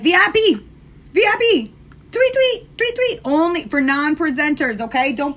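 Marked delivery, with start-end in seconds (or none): 0.0-3.2 much higher, chirpy chant climbing up; sing-song pestering. Retold or imitated. Pestering the lash artist with messages, high chirpy chant. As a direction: chirp it like a nagging little bird. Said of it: "and be like".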